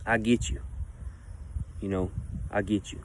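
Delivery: soft, low, gentle reassurance; tender. Real, not acted. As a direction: say it softly with love. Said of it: "show nothing but love".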